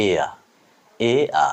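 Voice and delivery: এয়া with no final r; careful British-style এয়া, r dropped; British pronunciation. Shown as a demonstration. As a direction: say এয়া as "ey-a", ending open. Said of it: "ব্রিটিশরা উচ্চারণ করে".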